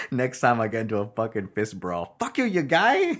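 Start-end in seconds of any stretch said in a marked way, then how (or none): 2.2-3.1 very high mock South African insult, laughing; South African accent. Host imagining his next fist brawl, squeaky mock South African accent. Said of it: "in a South African accent".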